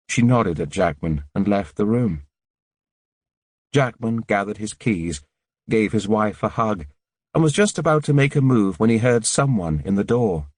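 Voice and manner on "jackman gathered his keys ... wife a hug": ordinary delivery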